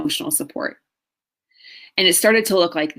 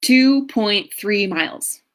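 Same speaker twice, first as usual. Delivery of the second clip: Two point three miles, slow and lifted; slow reveal; 3 semitones higher, slower than usual. Real, not acted. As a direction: land each word of the answer deliberately. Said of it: "Do you wanna know how far I made it?".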